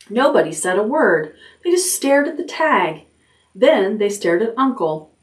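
usual speaking voice

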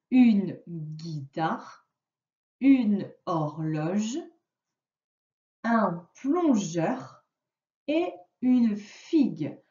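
slow, carefully spelled-out word list; slow dictation. Shown as a demonstration. Teacher dictating words to a pupil, slow and clear. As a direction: read each word slowly so the pupil hears the sound. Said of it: "Je vais te dire les mots".

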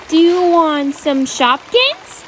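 plain reading voice

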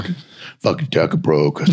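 lightly voiced gym bro. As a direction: toss it off like a meathead. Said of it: "I wanna answer in the bro voice".

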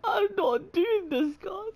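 high, near-tearful protest; distressed whine. Real, not acted. Player refusing to go on after the scare, distressed whine. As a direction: whine it in panic.

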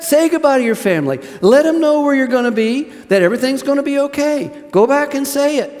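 high, loud, ringing encouragement; fervent preacher voicing easy reassurance; preaching at full force. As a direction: sell it like a generous promise. Preacher voices reasonable permission, full force.

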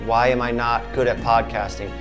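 normal talking voice

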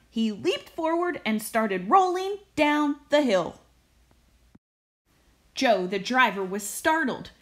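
usual speaking voice

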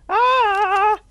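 high, drawn-out startled cry; startled scream. Real, not acted. Player spooked by the horror game, high scream.

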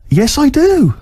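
high, eager acceptance; mock-keen, pitch jumping right up; eager yes. Buyer flattered by two blokes selling speakers, eager.

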